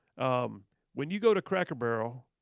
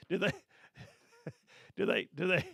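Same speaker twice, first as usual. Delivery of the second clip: chuckling through the words; amused laugh breaking up the question; 4 semitones higher, faster than usual. Real, not acted. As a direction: laugh while asking. Laughs at the headline.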